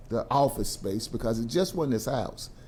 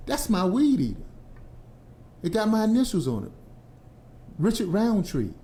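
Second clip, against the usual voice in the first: mock insistence; 6 semitones higher than usual. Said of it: "neighbor insists".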